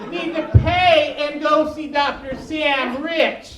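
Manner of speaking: slow, loud, drawn-out words; mock doctor's staff speaking slowly; slow mock instruction. Clinic staff telling the deaf comic, slow and loud.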